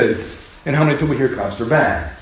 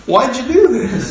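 high, accusing question; accusing quote; 7 semitones higher than usual. Retold or imitated. Angry Israelites confronting Moses, accusing him in a higher voice. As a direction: throw the blame at the leader.

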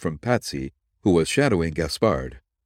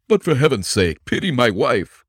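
higher, louder desperate plea; arrested husband begging the detective; pleading suspect; 5 semitones higher, 5 dB louder than usual. Acted. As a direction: beg for his wife's sake.

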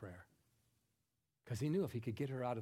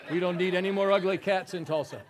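louder, laughing through the line; laughing punchline; 4 semitones higher, 12 dB louder than usual. Acted.

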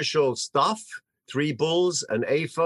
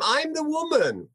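insistent; 10 semitones higher than usual. Acted. Hannah insisting to Eli.

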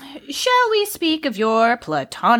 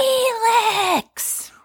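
high, drawn-out pleading call of a name; pestering, pitched-up interruption; insistent call; 9 semitones higher than usual. Acted. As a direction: cut in and stretch the name out. Second interruption of the reader.

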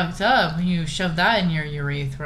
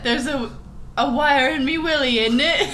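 mock British accent; 6 semitones higher than usual. Quoting British TikTok voice.

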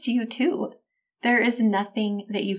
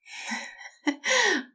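high laugh; 10 semitones higher than usual. Real, not acted. Laughs after her own question.

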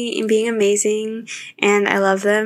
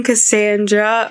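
ordinary talk, nothing marked

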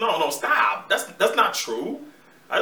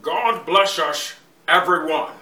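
slow, louder, drawn-out Connery take; Sean Connery impression; 7 dB louder, much slower than usual. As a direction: give it the slow Connery rumble. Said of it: "Mister Connery".

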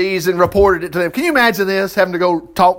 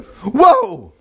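sudden mock amazement, a bit louder; amazed whoa; 3 semitones higher, 4 dB louder than usual. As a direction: gasp it out like you see it happen.

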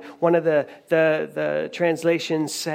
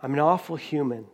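softer, subdued self-reproach; full of shame; 3 semitones lower, 4 dB quieter than usual. Acted. Peter facing Jesus after denying him, quietly ashamed. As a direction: voice a quiet, understated admission of shame. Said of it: "when you're full of shame".

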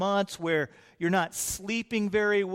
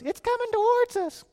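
put-on much higher, boyish teenage voice; young John. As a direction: play the nervous youngest disciple. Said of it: "John goes".

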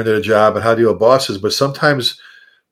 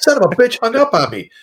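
much higher, indignant squawk; indignant outburst; 10 semitones higher than usual. Retold or imitated. Friend hung up on him, he squawks in outrage. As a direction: yelp it in outraged disbelief. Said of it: "I'm like".

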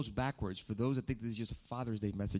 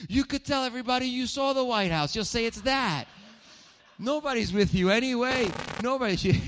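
much higher, louder dad impression; high loud mock dad; 13 semitones higher, 9 dB louder than usual. Preacher imitating his dad teasing his mom, high and loud.

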